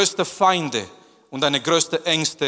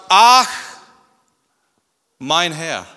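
dismayed groan; 7 dB louder, slower than usual. Acted. Gideon's reaction, repeated.

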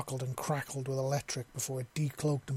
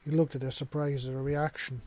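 ordinary delivery, nothing marked

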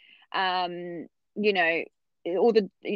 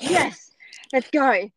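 higher and louder, mock eager; eager yes; 5 semitones higher, 5 dB louder, much slower than usual. To the obstetrician who says push, an eager yes. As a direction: jump at the chance to push.

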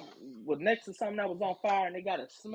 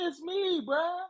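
higher, pleading mock voice; whiny manager; 9 semitones higher, slower than usual. Mocking the manager who pleads on the phone, whiny and higher. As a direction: whine like a caught manager.

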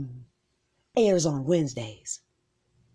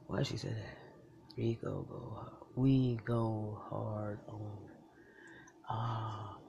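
whispering; 8 semitones lower, 15 dB quieter than usual. Pretend. Unvoiced whisper.

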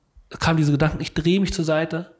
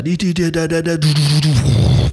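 rhythmic syllables swelling louder into breath; imitated trip pulse; 5 dB louder than usual. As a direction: mimic a relentless machine pulse.

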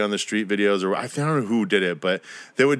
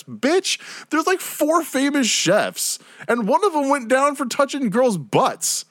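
much higher, slow incredulous squeal; exasperated outburst; 13 semitones higher, slower than usual. Real, not acted. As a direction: rant in high-pitched disbelief. Exasperated rant about fake chef fame.

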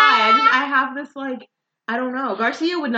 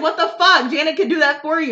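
mock exasperation; 6 semitones higher, 4 dB louder than usual. Acted exasperated Eleanor.

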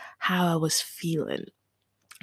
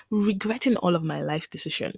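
ordinary delivery

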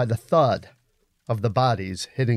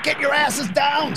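high, urgent warning shout; urgent yell. Acted. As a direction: yell for everyone to take cover. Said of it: "someone yelled".

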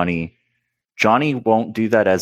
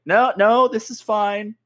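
high, cheerful mock Johnny; 11 semitones higher, slower than usual. Johnny bailing water on the ship, cheerful mock voice. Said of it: "And he's like".

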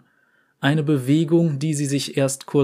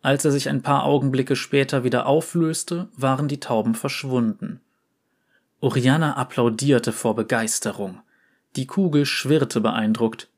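usual speaking voice

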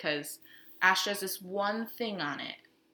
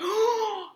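sharp high mock gasp; shocked gasp; 14 semitones higher than usual. Priest hearing a killer's confession, gasping high. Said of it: "father's just like".

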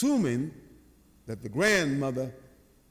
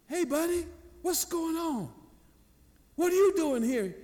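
lightly voiced friendly officer, pitched high; high-pitched talking-to-a-child voice; officer addressing a child; 13 semitones higher than usual. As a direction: coax a little boy in a higher voice. Officer greeting a frightened lost boy.

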